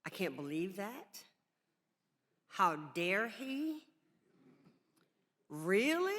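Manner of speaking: slow, stunned "how dare he", then a sharp high "really"; disbelief and anger. Retold or imitated. Panelist reliving her reaction to the play, outraged disbelief. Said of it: "My emotions went from".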